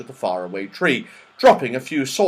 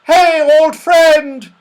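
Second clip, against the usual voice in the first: high, drawn-out shouted greeting; shouting; 12 dB louder, much slower than usual. Acted. Saucepan man hailing his old friend, delighted shout. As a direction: holler slowly down to an old pal. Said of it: "heard his friend's shouts".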